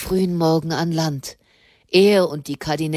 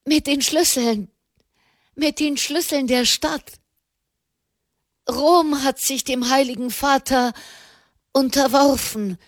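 higher, tumbling rush of joyful news; gushing excitedly; 6 semitones higher than usual. A narrator's reading. Prelate blurting Rome's surrender to the pope, excited. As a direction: let it spill out, barely containing yourself. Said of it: "sprudelte Malherbe heraus".

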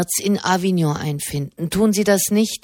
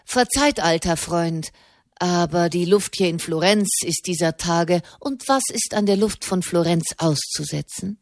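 ordinary delivery, nothing marked

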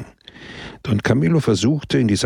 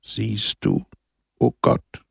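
smiling murmur; slower than usual. A narrator's reading. Don Camillo confides in God, smiling murmur. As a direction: murmur it with a slight smile. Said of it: "murmelte lächelnd Don Camillo".

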